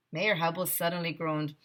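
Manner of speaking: ordinary delivery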